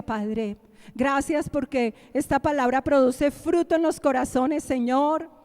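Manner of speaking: closing prayer after the sermon, fervent and swelling. Acted. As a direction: pray to God with growing fervour. Closing prayer over music.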